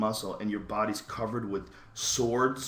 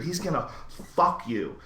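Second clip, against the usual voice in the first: normal talking voice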